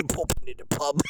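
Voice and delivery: jokey put-on Cockney; Cockney accent. Streamer riffing on going to the pub, playful Cockney accent. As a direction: do a silly Cockney pub-goer. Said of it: "Cockney accent".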